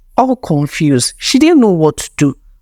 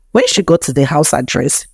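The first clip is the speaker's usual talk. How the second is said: usual speaking voice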